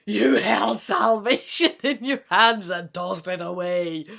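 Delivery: loud dragon voice with a laugh; gloating dragon. Acted. Dragon mocking the human for tossing the ring, loud gloat. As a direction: sneer it down from above. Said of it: "The dragon chortles evilly".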